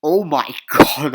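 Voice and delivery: loud, drawn-out gush with a laugh; hammed-up eager reaction; mock excitement. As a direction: gush it slowly and loudly. Mocks own eager reaction to pitch.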